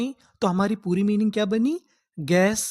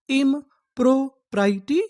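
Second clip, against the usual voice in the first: इम्प्रोप्राइटी stretched slowly, higher, rising at the end; slow demo; 4 semitones higher, much slower than usual. Shown as a demonstration. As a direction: drag इम्प्रोप्राइटी out for the students. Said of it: "तो हम लोग उसे English में क्या बोलेंगे?". (इम्प्रोप्राइटी धीरे-धीरे खींचकर)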